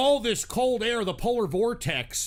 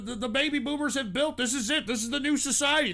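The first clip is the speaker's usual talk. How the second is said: ordinary talk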